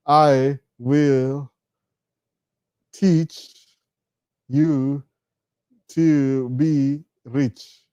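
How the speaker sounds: word by word with long pauses; slow dictation. Real, not acted. Typing a title for viewers, slow dictation. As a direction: spell it out at typing speed, stretching each word.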